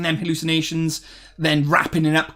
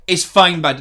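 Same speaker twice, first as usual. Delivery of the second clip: usual speaking voice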